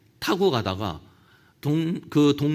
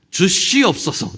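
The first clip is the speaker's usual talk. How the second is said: slow, louder pious prayer ending; 3 semitones higher, 5 dB louder, slower than usual. Retold or imitated. Preacher mimicking habitual prayer, drawn-out 주시옵소서. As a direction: draw out 주시옵소서 like a churchgoer. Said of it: "이렇게 끝나잖아요".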